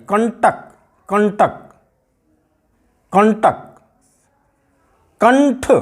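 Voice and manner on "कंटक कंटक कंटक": कंटक with a clear n before ट; nasal n sound shown. Shown as a demonstration. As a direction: say कंटक slowly, letting the anusvara sound as n. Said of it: "ना की आवाज़ आ रही है". (कंटक में अनुस्वार को न की तरह बोलिए)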